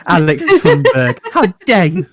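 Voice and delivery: laughing, hammed-up "how dare you"; a friend mocking the outrage, laughing; mock Thunberg scolding. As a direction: play it as a teasing impression. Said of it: "Greta Thunberg quip".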